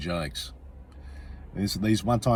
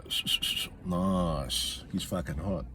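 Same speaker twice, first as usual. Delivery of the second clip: mock lustful murmur; 2 semitones lower, 6 dB quieter, slower than usual. Mimics guy ogling the TV.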